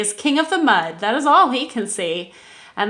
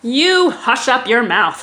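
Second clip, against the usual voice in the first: loud, slow scolding; howled rebuke; 3 semitones higher, 5 dB louder, slower than usual. Acted. Yertle silencing Mac, loud slow howl. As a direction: snap him silent from above. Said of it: "howled the mighty King Yertle".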